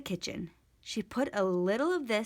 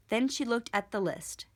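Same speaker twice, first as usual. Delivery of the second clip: usual speaking voice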